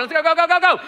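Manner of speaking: high, rapid-fire urging; high-pitched hurry-up chant; impatient urging. Retold or imitated. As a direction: play the impatient driver egging on the car ahead. Voicing his flashing headlights.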